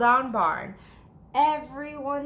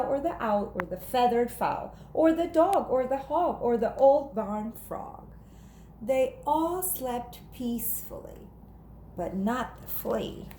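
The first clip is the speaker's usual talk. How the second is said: plain reading voice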